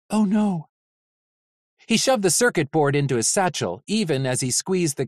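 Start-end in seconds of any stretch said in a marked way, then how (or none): none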